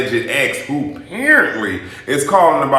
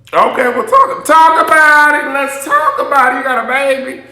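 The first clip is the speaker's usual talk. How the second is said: mock excited reaction; 12 semitones higher, 5 dB louder than usual. Reacting to Kevin saying he has a kid, high and squealing. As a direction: stretch the talk out in a high squeal.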